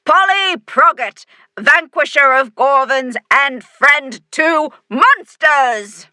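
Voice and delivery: high, loud slow proclamation; shouting. A narrator's reading. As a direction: announce her like a herald. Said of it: "she shouts".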